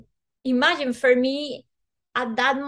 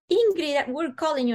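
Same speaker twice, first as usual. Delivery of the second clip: impatient maid; 6 semitones higher, 3 dB louder than usual. Retold or imitated. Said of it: "she's like".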